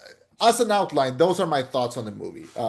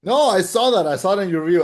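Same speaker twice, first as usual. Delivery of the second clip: usual speaking voice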